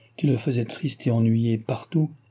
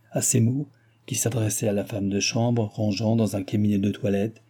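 ordinary delivery, nothing marked

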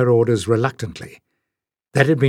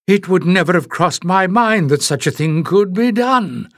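piper exclaiming; 7 semitones higher, 3 dB louder than usual. A narrator's reading. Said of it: "the piper exclaimed".

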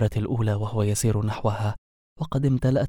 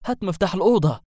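shouting; 7 semitones higher, faster than usual. A narrator's reading. Wizard yelling at the werewolf for the room key. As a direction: snap the order out at last. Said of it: "صاح به الساحر".

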